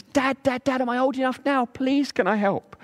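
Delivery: put-on high begging child's voice, slowing on please; pleading little boy. Carlos begging on his birthday, high child voice. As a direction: beg hard like a boy who has waited years.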